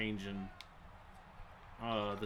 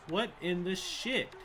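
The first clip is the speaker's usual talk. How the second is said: ordinary talk, nothing marked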